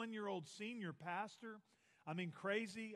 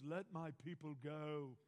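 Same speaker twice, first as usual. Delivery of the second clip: low, lightly voiced booming movie Moses; a hint of epic film prophet; movie Moses line; 4 semitones lower, 4 dB quieter than usual. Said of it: "maybe you've seen the movies".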